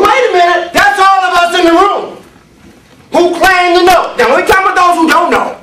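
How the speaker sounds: high-pitched pulpit shout; forceful preaching. Real, not acted. Preacher applies the verse to all in the room, forceful preaching. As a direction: pitch it high and hold it there.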